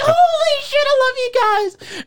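very high, loud "holy shit"; overjoyed gushing. Retold or imitated. Eric thanking his friends, very high and loud. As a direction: gush it out overwhelmed with joy.

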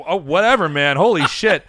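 a driver losing his cool at his passenger; mock-shocked taxi driver; freaked-out driver. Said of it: "the guy's like".

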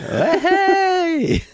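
high, loud squeaky alien yelp; mock Babu Frick shout. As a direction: burst out like the little droidsmith. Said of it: "uh, Babu Frick".

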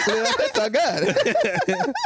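high, slow, drawn-out parody through laughter; giggly mock streamer voice; laughing catchphrase parody. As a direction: stretch it out high while cracking up. Mocking streamer catchphrase while laughing.

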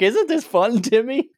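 high, sing-song fake cheer; chirpy tour guide asking a child; cheery park host. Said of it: "they're like".

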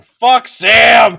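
huge, drawn-out shout; exasperated heckler bellow; mock outraged yell. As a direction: yell it high and long. Heckles the endless movie ending.

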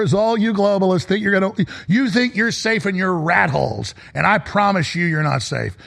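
usual speaking voice

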